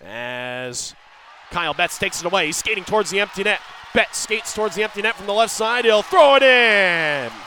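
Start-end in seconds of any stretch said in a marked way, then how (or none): 2.5-7.3 rising and fast, then a loud stretched finish; excited goal call. Real, not acted. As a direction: build to the empty-net goal. Empty net goal call.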